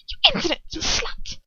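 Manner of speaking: high, far-off witch voice hissing the insult; hissed insult. A narrator's reading. Bellatrix hissing at her captive, high and venomous. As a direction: spit it out like a cruel witch. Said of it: "Bellatrix hisses".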